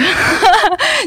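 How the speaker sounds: bright high giggle; high laugh. Real, not acted. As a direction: burst into a giggle. Laughing at the guest's fame.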